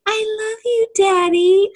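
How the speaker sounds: high, syrupy imitation of a child; mock little-kid voice. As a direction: mimic an overdone movie kid talking to Daddy. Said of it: "No little kid talks like this".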